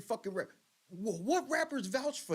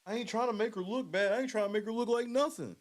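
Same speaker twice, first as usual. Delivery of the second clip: mock imitation; 3 semitones higher than usual. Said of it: "he says".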